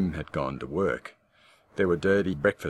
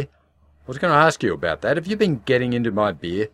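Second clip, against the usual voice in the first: loud, higher, suspicious jab; accusing husband; 6 semitones higher, 7 dB louder than usual. Acted. Husband accusing wife of drinking his beer, suspicious and loud. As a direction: throw the accusation back at her.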